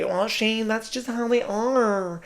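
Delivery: high, soft, drawn-out catty objection; put-on catty whine; mock cunty. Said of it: "when I'm trying to be cunty".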